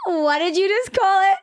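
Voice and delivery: can't believe what she just heard; incredulous. Retold or imitated. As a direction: friend mocks her saying queso, incredulous high loud. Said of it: "I said".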